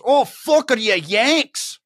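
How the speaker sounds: high, drawn-out imitated Scottish accent; Scottish lassie. Retelling a lassie stopping at their table, Scottish lassie. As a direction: exclaim it as a mock Scottish lass. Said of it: "some lassie who looks like".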